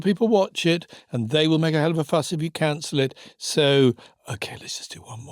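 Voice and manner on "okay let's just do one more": whispered. Retold or imitated. Scheduler's resigned inner decision.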